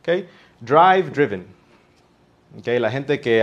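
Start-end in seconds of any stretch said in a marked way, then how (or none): 0.6-1.5 "Drive driven" with "ai" in the first, short i in the second; vowel contrast. Shown as a demonstration. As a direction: contrast "DRAIV" against "DRIV-en". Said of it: "el sonido de la vocal cambió".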